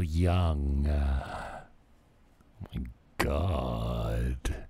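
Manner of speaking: slow mocking vocal fry. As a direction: imitate a bored vocal fry. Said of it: "I need to put on this voice".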